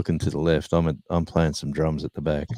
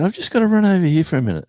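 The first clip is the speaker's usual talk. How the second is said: much higher, louder and fast, making an excuse; hurried excuse; 13 semitones higher, 7 dB louder, faster than usual. Retold or imitated. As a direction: blurt a quick getaway line in a raised voice. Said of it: "And then I said".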